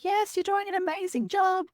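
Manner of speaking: a high, gentle voice of encouragement; reassuring midwife. Retold or imitated. Midwife reassuring her in the bath.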